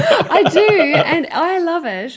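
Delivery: high laughing admission. Real, not acted. Guest owning up to her nana nap, laughing. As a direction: own it through a laugh.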